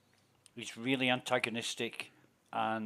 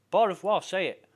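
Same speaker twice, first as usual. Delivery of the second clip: louder, higher pushy demand; insistent demand; 7 semitones higher, 7 dB louder than usual. Retold or imitated. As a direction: push him to repeat it. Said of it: "he said".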